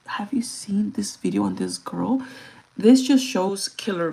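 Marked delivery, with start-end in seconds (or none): 0.1-2.2 whisper. Real, not acted. Conspiratorial question.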